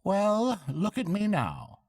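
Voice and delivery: high, hearty barge woman; bright country woman chatting. A narrator's reading. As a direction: barge woman offering a lift.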